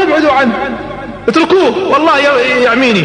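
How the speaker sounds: high, urgent cry; a man in pain pleading; panicked plea. Retold or imitated. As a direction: beg them to back off. Utba screams in pain.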